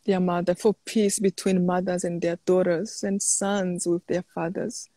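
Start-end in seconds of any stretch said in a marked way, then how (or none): none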